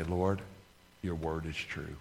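softly, low-key declaration; quiet prayer. Shown as a demonstration. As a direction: say it softly to God. Quiet personal prayer, repeated.

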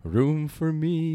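slow, drawn-out ghostly voice; lightly voiced spooky ghost.

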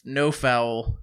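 usual speaking voice

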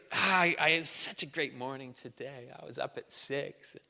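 lightly voiced smug bragger; smug bragging friend. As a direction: brag quietly about your perfect morning. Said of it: "There's the over-the-top person".

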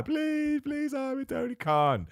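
very high, squeaky pleading voice; mock crying plea. Mocking a fan begging Tony Khan, in a squeaky high plea. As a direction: beg like a starstruck fan. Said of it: "and you cry".

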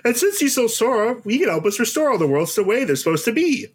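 high chipmunk voice; Chip voice. Acted. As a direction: squeak like a cartoon chipmunk. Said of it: "Chip goes".

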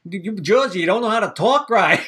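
hammed-up Bostonian telling someone off; imitated Boston accent. Bostonian teasing a Jersey resident, mock Boston accent. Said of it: "I've talked to people from Boston".

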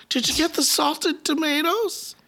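high, slow, sweet mom question. Retold or imitated. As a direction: ask like a cheerful mother. Mom voiced asking about lunch.

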